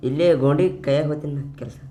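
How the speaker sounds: low, soft, deferential voice; subdued. Acted. Young man tells older brother of a job, subdued. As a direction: drop right down, quiet and humble.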